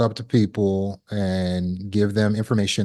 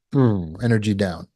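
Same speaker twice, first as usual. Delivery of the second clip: ordinary talk, nothing marked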